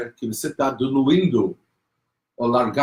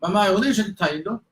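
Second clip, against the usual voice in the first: put-on worried child; 7 semitones higher than usual. Child asks again, worried.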